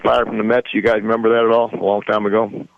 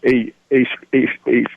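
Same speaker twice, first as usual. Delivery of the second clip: ordinary delivery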